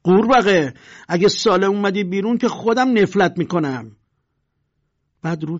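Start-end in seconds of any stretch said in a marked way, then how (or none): 0.0-4.0 loud, higher menacing threat; angry bully's warning; threatening. Acted. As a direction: curse the teen through the door. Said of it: "به تهدید گفت".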